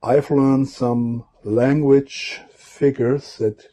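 ordinary talk, nothing marked

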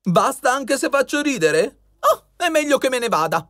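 high-pitched, put-out jester voice; piqued jester. A narrator's reading. Saltacavalla threatens to leave the king, piqued. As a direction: protest in a high, huffy character voice. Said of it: "fece un gesto di stizza".

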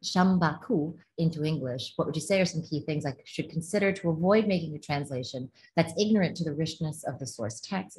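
usual speaking voice

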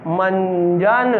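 मंजन drawn out slowly; slow classroom reading of मंजन; slow syllable demo. Shown as a demonstration. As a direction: model मंजन slowly for children. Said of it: "teacher models word slowly".

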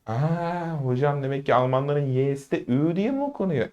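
soft, hushed pupil catching on; quiet dawning-realisation question; student realising. Acted. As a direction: play a student softly working it out. Acted student suddenly realising.

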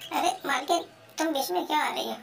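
usual speaking voice

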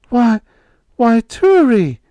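high, loud and slow with astonishment; startled cry. A narrator's reading. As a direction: cry out in shock. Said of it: "Babs cried".

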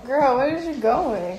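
startled loud yell; loud shout. Real, not acted. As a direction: shout it out suddenly. Startling sister in dark.

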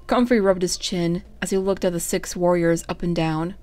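ordinary delivery, nothing marked

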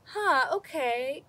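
usual speaking voice

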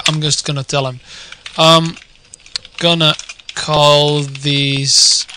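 usual speaking voice

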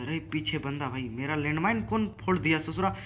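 ordinary talk, nothing marked

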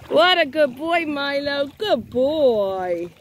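high, sing-song praise for a good dog; doggy praise voice. Acted. Mom praising her dog after his swim, high and sing-song. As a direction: coo at the pup like a proud mom.

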